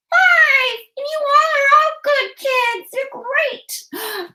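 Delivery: very high, gushing puppet voice; excitable high-pitched helper; Rose. Acted. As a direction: cheer the kids on in a high squeaky character voice. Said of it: "Rose is gonna come back".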